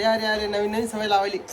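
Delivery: ordinary talk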